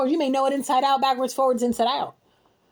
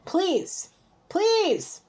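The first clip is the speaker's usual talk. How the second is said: exasperated pleading; 6 semitones higher than usual. Real, not acted. Pleading with person to stop.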